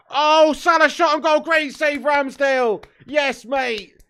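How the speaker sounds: high, loud burst at the save; excited shout. Real, not acted. Fan shouting as the keeper makes the save, excited. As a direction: yell it as the keeper saves. Live shot on goal saved.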